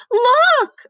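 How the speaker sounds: very high, loud yell. Acted. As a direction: shout it across the classroom. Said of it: "I yelled".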